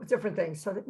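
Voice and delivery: usual speaking voice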